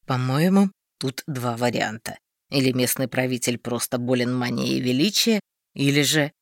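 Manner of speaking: a hint of a dry skeptic; low grumbling voice. Darvald voiced lower.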